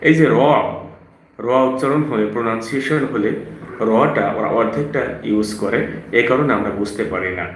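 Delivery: normal talking voice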